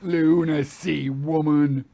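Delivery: spat contempt. A narrator's reading. Captain glaring close at Vera, spitting contempt. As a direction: spit the words in her face. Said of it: "he spit at her".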